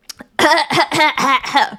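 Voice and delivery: coughing after swallowing wrong; sputtering cough, air down the wrong pipe; choking cough. Real, not acted. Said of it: "wrong pipe".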